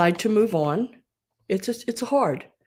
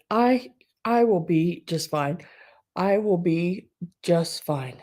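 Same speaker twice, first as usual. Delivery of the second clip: ordinary talk, nothing marked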